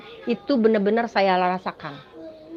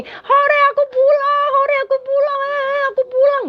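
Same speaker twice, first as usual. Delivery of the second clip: high, bright, happy sing-song cheering; joyful cheer; 5 dB louder than usual. Retold or imitated. Dreamer shown the way home, cheering with joy. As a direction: cheer like a kid going home. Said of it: "Aku bilang begini".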